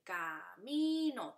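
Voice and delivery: "camino" broken as ca-mi-no for learners; split into syllables. Shown as a demonstration. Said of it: "se divide".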